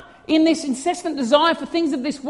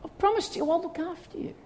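tender reassurance; 3 semitones higher, 5 dB quieter than usual. Retold or imitated. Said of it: "He says".

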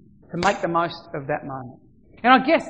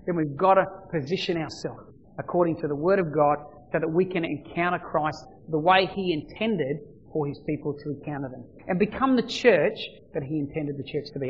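ordinary talk

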